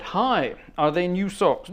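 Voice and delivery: normal talking voice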